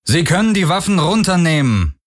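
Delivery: high, projected announcement across the water; calling over loudspeaker. A narrator's reading. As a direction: call out as if through a loudspeaker. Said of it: "sagte eine männliche Stimme über Lautsprecher".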